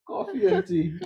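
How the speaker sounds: laughing mock. Laughing mock of host's offer.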